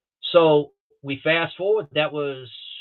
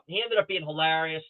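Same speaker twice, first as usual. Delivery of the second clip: ordinary talk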